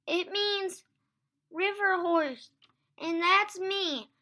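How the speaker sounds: high, loud, wailing lament; tearful. A narrator's reading. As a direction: moan about it in despair. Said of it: "She started to cry again".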